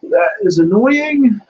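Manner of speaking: high, slow drawn-out grumble; exasperated. Real, not acted. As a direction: stretch out the annoyance theatrically. Link fails, mock exasperation.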